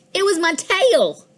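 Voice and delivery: a cat's startled high discovery; surprised reveal. Acted. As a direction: realize it's your own tail. Cat discovers the shadow is its tail.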